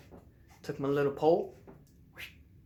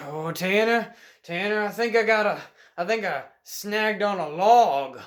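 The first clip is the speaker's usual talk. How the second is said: raised, louder call to a friend; 5 semitones higher, 6 dB louder than usual. Retold or imitated. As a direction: re-enact hollering for a friend while straining. Calling friend while snagged.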